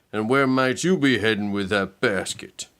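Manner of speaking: lightly voiced sly scoundrel; a hint of a sneaky villain asking; sly wolf voice. Scoundrel Pete sizing up Red, a touch sly.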